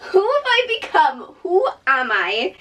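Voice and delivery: high, giggling disbelief; laughing disbelief. Real, not acted. Skipping her pre-holiday pamper for a long run, laughing disbelief.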